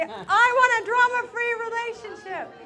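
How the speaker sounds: high, theatrical loud proclamation; loud with drama. Shown as a demonstration. Speaker rallying the room, arm in the air, loudly dramatic. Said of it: "everybody do a drama".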